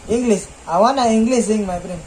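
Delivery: fake Indian accent. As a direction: lay on a put-on Indian English accent, friendly.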